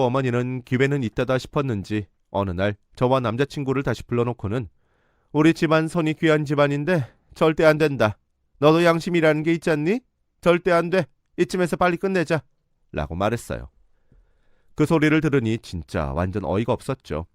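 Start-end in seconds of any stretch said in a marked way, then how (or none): 5.3-12.4 higher and louder, flatly forbidding; mother ordering the couple to break up, higher and louder; stern forbidding mother. Retold or imitated. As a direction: hammer down the refusal like a furious mother.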